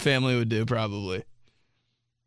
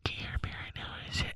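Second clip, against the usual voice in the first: rapid, hushed mock threat; close mom whisper; 9 dB quieter, much faster than usual. Imitating mom scolding her kid, rapid whisper. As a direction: whisper punishments quickly into the ear. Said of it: "she would whisper so close to my ear".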